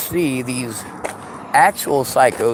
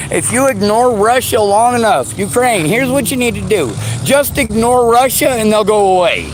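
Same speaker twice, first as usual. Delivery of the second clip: sarcastic, raised, sing-song lecturing; sneering at the "just ignore it" crowd; mocking advice; 6 semitones higher, 4 dB louder than usual. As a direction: parrot their advice with contempt. Mocking people's advice to ignore Russia.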